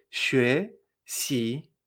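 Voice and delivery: "Xue xi" split, each syllable rising; two separate lifts on "Xue xi"; spaced-out "Xue xi", a question on each syllable; tones two-two. Shown as a demonstration. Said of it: "me extraño dos veces".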